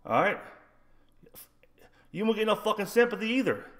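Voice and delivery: ordinary talk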